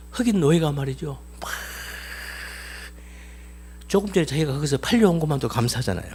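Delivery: ordinary delivery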